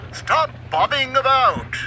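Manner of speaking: high, sharp order to the boat; commanding. A narrator's reading. Sailor scolding his boat, firm command. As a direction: snap the order out like a captain. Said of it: "he commanded".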